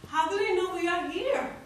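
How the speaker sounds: high, loud, urgent question; alarmed mother. Acted. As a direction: ask it in rising alarm. Mother alarmed as rebels approach.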